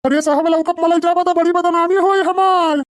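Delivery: lightly voiced whining old man; pleading old man. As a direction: plead to save your reputation. Old man pleads against lockup.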